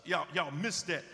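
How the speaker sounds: ordinary talk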